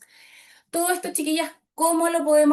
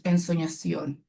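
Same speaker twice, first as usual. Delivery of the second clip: "Ensoñación" dropped much lower, slowed and dreamy; 7 semitones lower, slower than usual. Shown as a demonstration. Coach showing a deep, dreamy word. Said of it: "palabras más graves".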